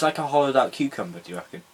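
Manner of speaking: ordinary talk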